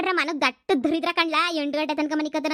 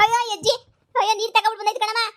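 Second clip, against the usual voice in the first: shocked outcry; 6 semitones higher, faster than usual. Acted. Just drenched with water.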